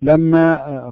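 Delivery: ordinary talk, nothing marked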